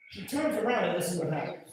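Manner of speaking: ordinary delivery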